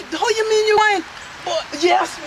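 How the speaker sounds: ordinary talk, nothing marked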